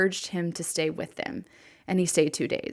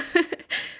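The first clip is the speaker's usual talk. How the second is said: little laugh, fighting back tears; teary breathy laugh; 11 semitones higher than usual. Real, not acted. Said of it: "Sorry to get teary on you".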